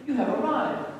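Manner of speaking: high, excited motherly welcome; great excitement. A narrator's reading. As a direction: greet a long-awaited son with delight. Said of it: "greeted him with great excitement".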